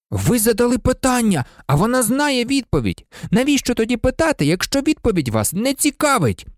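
high-pitched, heated pupil's protest; outraged, high exclamation; indignant outburst. A narrator's reading. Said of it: "обурено вигукнув".

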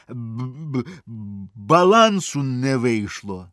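teeth-chattering stutter, slow; stammering from cold. A narrator's reading. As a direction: stutter as if frozen through. Змерзлий приятель заїкається. (заїкається від холоду)

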